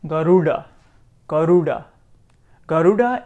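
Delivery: Garuda with a retroflex d, tongue curled back; Sanskrit Garuda. Shown as a demonstration. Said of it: "we have the word".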